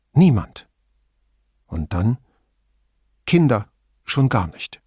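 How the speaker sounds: terse middle-aged woman, wary; Signora Toso voice. A narrator's reading. Toso speaks.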